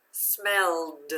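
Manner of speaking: "Smelled" ending in a clear voiced d. Shown as a demonstration. Teacher reads the word with the student, "Smelled" with voiced d. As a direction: sound out the final d in "Smelled". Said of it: "I made the d sound".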